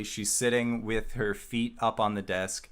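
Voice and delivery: ordinary talk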